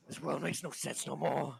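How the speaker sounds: mildly agitated despair; distraught survivor. Acted. Distraught survivor despairs while pacing.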